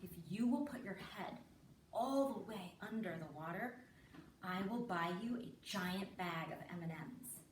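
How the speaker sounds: low, soft, deliberate offer; coaxing bribe. Retold or imitated. Mother bribing daughter to duck under, measured and coaxing. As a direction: spell out the bargain quietly, with weighty pauses. Mother slowly bargains with child.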